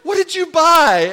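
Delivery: like reading a shocking receipt aloud; outraged disbelief. Retold or imitated. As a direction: shriek the price in outrage. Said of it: "You know, like".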